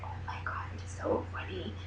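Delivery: hushed, giggly whisper. Real, not acted. Whispering to viewers about the fiance's chubby photos. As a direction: keep it secret, whisper it.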